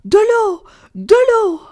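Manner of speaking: high, loud scream for water. Acted. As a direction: howl it out across the hills. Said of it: "et hurlait".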